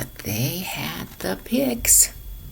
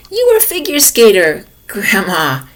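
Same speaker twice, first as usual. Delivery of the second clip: excited realization; 2 semitones higher, 8 dB louder than usual. Acted. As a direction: blurt out the discovery. Henry's sudden realization.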